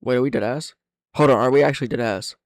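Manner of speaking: ordinary talk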